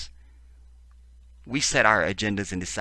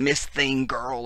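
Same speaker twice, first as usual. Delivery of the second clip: mock sassy scream; 6 semitones higher, 3 dB louder, slower than usual.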